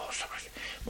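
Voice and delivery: whisper. Real, not acted.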